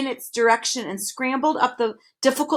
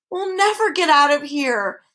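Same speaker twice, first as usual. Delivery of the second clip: moaning; 5 semitones higher, 4 dB louder than usual. A narrator's reading. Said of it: "the humbug moaned".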